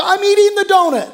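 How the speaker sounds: mock defeated glee; caving in. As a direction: give up with a bright yelp. Giving in to the donut.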